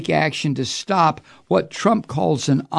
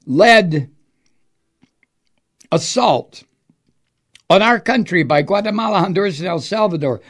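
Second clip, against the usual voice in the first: mock Trump quote; 4 semitones higher, 5 dB louder than usual.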